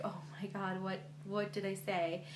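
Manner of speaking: quietly horrified at her own slip; hushed panic. Retold or imitated. Retold panic at stranger's tears.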